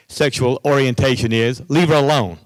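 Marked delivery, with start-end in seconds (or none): 1.7-2.4 stern. Retold or imitated. Principal scolding students.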